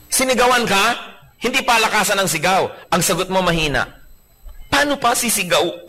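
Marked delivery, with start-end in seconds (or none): none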